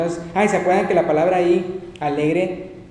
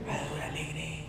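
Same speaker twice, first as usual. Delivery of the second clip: hushed answer; 13 dB quieter than usual. Acted. Congregation asked to fill the answer.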